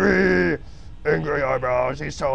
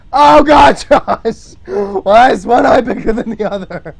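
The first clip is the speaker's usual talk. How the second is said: high, loud laughing horror; loud dismay; 7 semitones higher, 12 dB louder than usual. Real, not acted. Streamer reacting loudly to the lopsided eye. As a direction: cry out at the messy eye.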